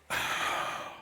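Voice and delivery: long breathy exhale; remorseful sigh. Real, not acted. Storyteller ashamed of his mistake, heavy sigh. Feels terrible recalling it.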